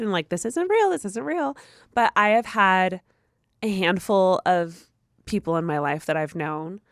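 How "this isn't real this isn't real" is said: mock terrified. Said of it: "being terrified".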